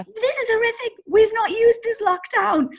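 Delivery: high-pitched mock horror; dramatic meltdown. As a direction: wail it like a drama llama. Retells her meltdown to Ben.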